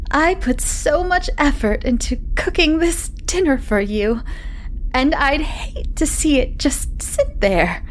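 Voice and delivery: high, slow, overly sweet wife; smooth it over, sickly sweet; wife's voice. Acted. Wife smoothing things over about dinner, high sweet voice.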